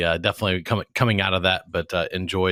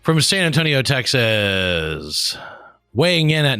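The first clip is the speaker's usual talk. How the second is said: higher, louder ring-announcer build-up; mock boxing announcer; 6 semitones higher, 4 dB louder, slower than usual. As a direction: introduce him like a fighter entering the ring. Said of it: "no, we're not gonna do that".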